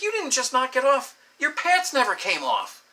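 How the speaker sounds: high, imitated incredulity; upset disbelief. Woman realizing his pants stayed on, retold high disbelief. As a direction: imitate her, pitched high and stretched at the end.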